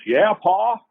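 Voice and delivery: high-pitched called-back reply; son answering father. Retold or imitated. Son answers his yelling father.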